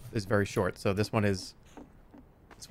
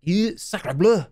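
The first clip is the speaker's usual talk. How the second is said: slow, higher mock French exclamation; French accent; 5 semitones higher, much slower than usual. Showing off French to amused friends, French accent. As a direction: stretch it out in a hammed-up French accent. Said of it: "say in a French accent".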